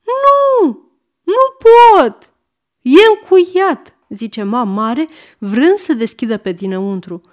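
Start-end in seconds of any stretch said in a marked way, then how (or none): 0.1-3.9 put-on very high, loud boy's yell; bawling child. A narrator's reading. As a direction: wail helplessly from behind the door. Trapped boy bawls answers. (copil care zbiară)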